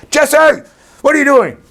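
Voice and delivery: high, loud scolding call; yelling at dog. Retold or imitated. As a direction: yell at the dog from the doorway. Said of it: "why I'm yelling at him".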